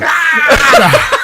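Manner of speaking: short loud mock yell with a laugh, voicing Travis. Retelling Travis reacting to calm music, a loud laughing yell. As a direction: bark out a startled cry. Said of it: "he's just like".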